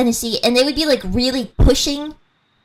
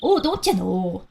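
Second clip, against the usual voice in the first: slow, drawn-out Fargo accent; 3 semitones lower, slower than usual. Shown as a demonstration. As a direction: stretch the o's, mock Fargo. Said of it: "It's like Fargo".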